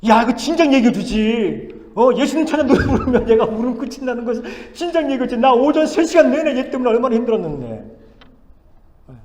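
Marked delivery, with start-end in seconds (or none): 0.1-8.0 loud, high-pitched exasperated protest; acted complaint. Volunteer complaining to his teacher friend after a hard morning, exasperated. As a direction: re-enact griping at a close friend. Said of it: "제가 친구 선생님한테 얘기했어요".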